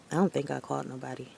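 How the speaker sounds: ordinary talk, nothing marked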